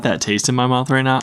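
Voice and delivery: usual speaking voice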